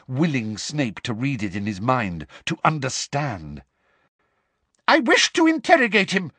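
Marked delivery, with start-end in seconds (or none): 4.9-6.3 much higher, louder furious demand; angry shout. A narrator's reading. Umbridge demands to interrogate Harry, shouting angrily. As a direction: shout it in rage. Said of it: "shouted Umbridge angrily".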